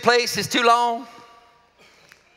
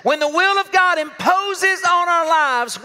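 higher, slow and pressed; slow emphatic; 7 semitones higher, 3 dB louder, slower than usual. Shown as a demonstration. Preacher building the phrase to the congregation. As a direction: build it up as a proclamation. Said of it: "I wanna say it like this".

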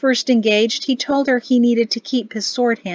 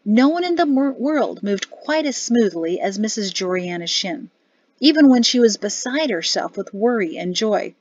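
usual speaking voice

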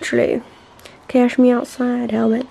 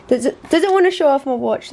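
ordinary talk

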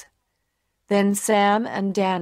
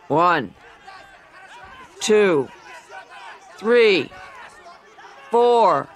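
referee count; slower than usual. A narrator's reading.

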